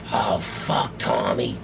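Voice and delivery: low, soft mutter of dread; quiet retold "uh-oh" moment; hushed dismay. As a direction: mutter it under your breath, dismayed. Retold dismay as star approaches.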